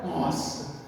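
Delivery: soft, low, awed murmur; hushed adoration. Acted. Maria listening at Jesus' feet, hushed adoration. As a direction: breathe it out in quiet wonder.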